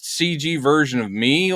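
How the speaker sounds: usual speaking voice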